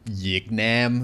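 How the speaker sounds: "Yik Nam" with a Y sound, no V; southern pronunciation. Shown as a demonstration. Explaining own family's Southern dialect, "Yik Nam" with Y for V. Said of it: "no V sounds even".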